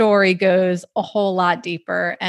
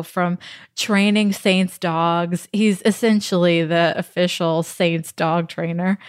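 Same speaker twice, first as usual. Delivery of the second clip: ordinary delivery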